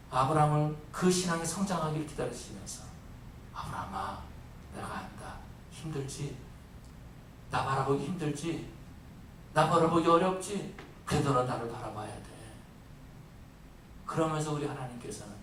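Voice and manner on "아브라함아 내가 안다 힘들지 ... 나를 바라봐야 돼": soft, low, gentle consoling words; tender God voice. Acted. God gently comforting a struggling Abraham, soft and tender. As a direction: comfort him like a patient father.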